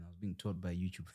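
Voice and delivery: embarrassed whisper. Real, not acted. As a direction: whisper it as a sheepish aside. Self-deprecating aside, unvoiced.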